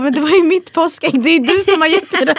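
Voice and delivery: high, laughing whiny protest; mock husband protest. Teller mimics husband complaining about his Easter egg, high and laughing. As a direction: play the hurt husband, half laughing.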